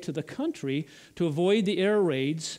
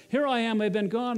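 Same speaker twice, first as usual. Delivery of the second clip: lightly voiced girl, pitched higher; a hint of a child calling out, higher; girl announcing her return; 6 semitones higher than usual. Lucy comes back through the wardrobe, lightly acted girl's announcement.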